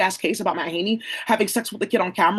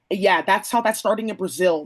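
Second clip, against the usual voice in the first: usual speaking voice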